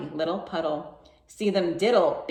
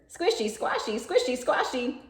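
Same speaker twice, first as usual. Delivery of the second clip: piggy's reply; 9 semitones higher than usual. A narrator's reading. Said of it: "But the piggy answered".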